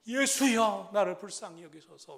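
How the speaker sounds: loud pleading shout; crying out. Retold or imitated. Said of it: "소리를 더욱 크게 질러서".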